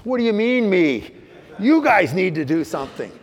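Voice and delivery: loud, high, outraged protest; indignant outburst. Retold or imitated. Man snapping back at the counselor, loud and indignant. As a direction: fire back in shocked indignation. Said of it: "I immediately do the Al-Anon coat of arms".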